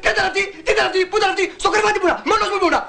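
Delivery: high-pitched, frantic outburst; hysterical denial. Acted. Husband denying anyone was in the bed, hysterical. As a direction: fire off indignant questions at a high pitch. Cornered husband denies everything.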